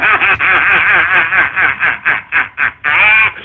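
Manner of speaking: long deep villain cackle; evil cackle. Acted. Streamer mocking trolls with an evil cackle. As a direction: cackle like a mad overlord.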